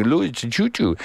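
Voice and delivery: put-on high sing-song baby talk. Shown as a demonstration. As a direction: coo it like talking to a baby. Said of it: "you know, baby talk".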